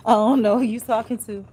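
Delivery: sassy retort. Real, not acted. Rebuffing the author's greeting.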